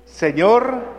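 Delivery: calling out. Shown as a demonstration. Priest asks the congregation how she said it, calling out. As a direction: cry out Señor to get his attention. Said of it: "A ver, ¿qué le dijo?".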